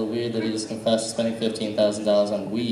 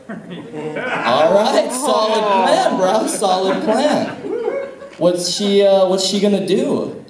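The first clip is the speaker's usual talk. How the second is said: mock impressed; 7 semitones higher, 4 dB louder, slower than usual. Comic quizzing a nine-year-old.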